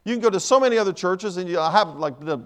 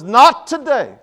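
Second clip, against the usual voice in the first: higher, much louder retort; defiant refusal; 7 semitones higher, 11 dB louder, much slower than usual. Acted. Woman refusing the devil's orders, loudly defiant. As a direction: throw it back forcefully.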